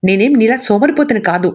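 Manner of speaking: put-on boy hitting back at his father, spitefully; spiteful shout. A narrator's reading. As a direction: spit it back at him like a sulky child. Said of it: "కసిగా అరిచాడు lorry".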